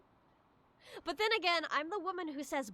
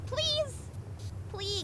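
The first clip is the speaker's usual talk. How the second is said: pleading; 11 semitones higher than usual. Real, not acted. Pleading in game.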